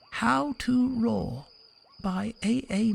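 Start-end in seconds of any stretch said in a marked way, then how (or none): none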